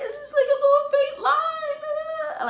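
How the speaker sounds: high, loud, sing-song wailing; hammed-up crying over a faint line; mock sobbing to best friend about the test; dramatic wail. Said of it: "being dramatic as fuck".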